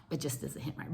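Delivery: It just doesn't hit right fully whispered, breathless. Real, not acted. Repeats it whispered.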